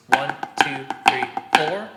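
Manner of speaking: every number punched loud: "one, two, three, four"; accented downbeat count. Shown as a demonstration. Drum teacher counting downbeats, emphatic count. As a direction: count out the beats, each one hit hard. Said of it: "four times with the accents on the downbeats".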